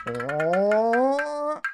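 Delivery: long rising high wail; falling cry. Acted. Game master voicing the collision, rising high wail. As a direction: let the wail climb on impact.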